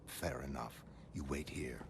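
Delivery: soft, low murmur to a pet; hushed aside. Acted. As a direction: drop to a near whisper. Gently leaves pet behind.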